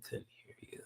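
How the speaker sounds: ordinary delivery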